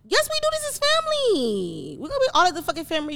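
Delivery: insist, stretching the word family; high insistent pleading. Real, not acted. Insisting family must stay cool.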